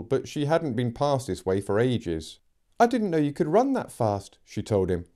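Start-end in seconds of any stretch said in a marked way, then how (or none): 2.8-4.4 put-on higher girl's voice. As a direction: voice young Megan, higher. Megan voiced by reader.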